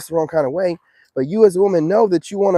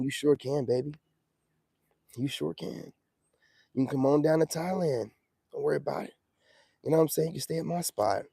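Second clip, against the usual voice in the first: soft, smooth sweet-talker voice; 2 semitones lower, 9 dB quieter than usual. Acted. Sweet-talking the women.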